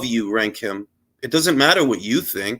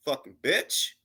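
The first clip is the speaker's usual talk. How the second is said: normal talking voice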